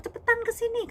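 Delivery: high, loud impatient summons; angry ordering. Retold or imitated. Father figure calling her for painful massage, angry ordering. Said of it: "Marah sama saya kan".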